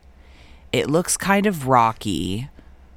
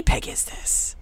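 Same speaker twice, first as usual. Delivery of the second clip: whisper; 5 dB quieter, slower than usual. Real, not acted. Whispered aside.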